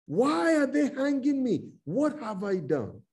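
high-pitched, pleading wail to a mother; begging cry. Acted. Preacher voices condemned boys begging their mothers, pleading. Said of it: "Those boys were begging their mothers".

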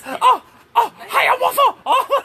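high, loud laughing oh; startled laughing yelp. Real, not acted. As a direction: yelp out in surprise.

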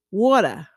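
"water" as "waw-tuh", R dropped; New York "water", rounded vowel and no final R; New York accent. Shown as a demonstration. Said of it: "if you're from New York".

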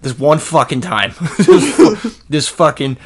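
hammed-up New York vet, loud and high; New York accent. Cousin bragging about Vietnam in a loud New York accent. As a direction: brag like a New York tough guy. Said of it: "I made him New York".

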